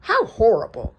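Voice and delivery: high fluttery goose voice; a lightly voiced startled goose; honking goose voice. A narrator's reading. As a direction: goose squawking in alarm. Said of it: "she honked".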